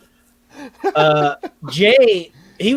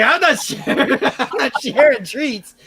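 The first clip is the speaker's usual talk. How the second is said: laughing; 5 semitones higher than usual. Retold or imitated. Refusing roommate snacks, laughing.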